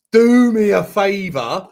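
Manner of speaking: loud, drawn-out mock protest; re-enacting an exasperated scoff; retold scornful dismissal. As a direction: stretch it out in loud disbelief. Retelling his scornful dismissal.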